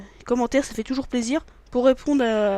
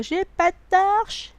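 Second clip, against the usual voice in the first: higher, slow drawn-out whine; mock whine; 8 semitones higher, 4 dB louder, much slower than usual. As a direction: stretch it like a mock complaint. Mock whiny complaint.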